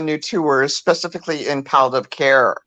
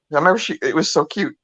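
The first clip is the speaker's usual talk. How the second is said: ordinary talk, nothing marked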